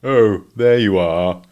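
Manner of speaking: slow, gloomy grumble; grumbling Eeyore. A narrator's reading. Eeyore grumbling about the washing, slow and gloomy. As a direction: drag it out like a glum donkey.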